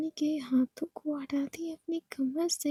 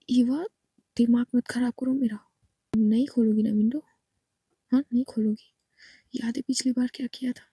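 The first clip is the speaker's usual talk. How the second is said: angry; 3 semitones lower, 3 dB louder, faster than usual. Acted. Angry Ishani threatens her wife Eva to open the door. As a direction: lower and louder, fast furious threats. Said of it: "उसको गुस्सा आ रही थी".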